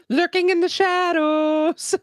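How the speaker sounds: high, slow spooky drawl; spooky mock voice. Teasing friend about Matt lurking, spooky and high. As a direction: creep it out like a ghost story.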